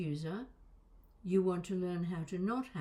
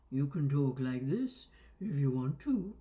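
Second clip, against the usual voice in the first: playful voice demo; 5 semitones lower than usual. Shown as a demonstration. Voice coach showing what voices can do, low put-on voice. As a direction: lower put-on voice with swoops. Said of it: "you can do things with it".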